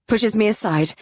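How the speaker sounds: plain reading voice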